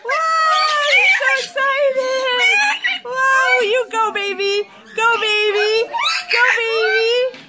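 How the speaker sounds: high-pitched, delighted cheering for a pet; excited cheering. Real, not acted. Owner cheers on her dancing bird, high and excited.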